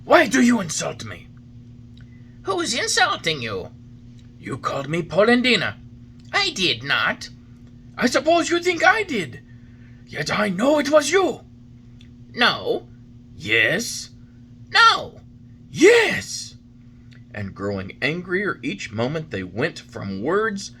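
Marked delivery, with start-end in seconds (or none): 0.0-1.3 higher, louder angry demand; offended old man confronting the carpenter. Acted. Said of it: "said to him angrily". 2.4-16.6 higher, louder bickering; two old men squabbling over an insult; quarrel. Acted.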